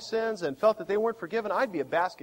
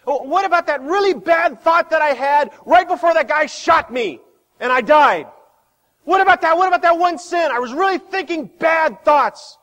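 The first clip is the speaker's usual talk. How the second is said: mock objector; 9 semitones higher, 11 dB louder than usual. Objector voiced again.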